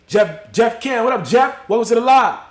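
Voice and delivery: loud, high-pitched shout-out; big hollered welcome, voice jumping up; shouted greeting. Real, not acted. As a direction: call out excitedly to someone just arriving. Greeting a viewer joining the live.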